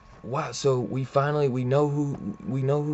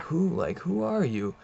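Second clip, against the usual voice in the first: higher-pitched mock bewildered question; retold startled question, pitched up; startled demand; 6 semitones higher than usual. Villain confronting his unknown attacker, startled demand.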